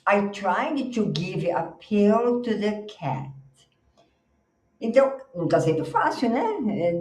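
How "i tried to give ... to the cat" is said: very slow, clear read; slow model sentence. Shown as a demonstration. Teacher reads the picture caption to the class. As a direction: read it slowly for learners. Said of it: "olha só a figura".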